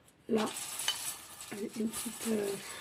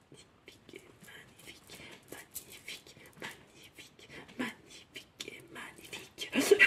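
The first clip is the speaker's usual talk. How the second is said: breathless whispered repetition; hushed magnifique repeated in a whisper; 3 semitones lower, 20 dB quieter than usual. Real, not acted. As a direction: whisper it over and over, almost to yourself.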